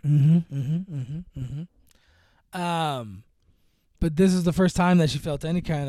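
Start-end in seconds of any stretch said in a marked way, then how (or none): none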